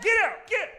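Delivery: very high, loud, comic yell; mock yelling. Crazy bystander chasing fans away, yelling high and loud. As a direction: shoo them off with a high holler. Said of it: "crazy person yelling in his front yard".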